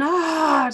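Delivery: a high, loud imitated "ah" of pain; labor moan. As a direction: moan it out like a contraction. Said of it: "lo que vocalizaba mucho era como un". (gemido de dolor imitado, agudo y fuerte)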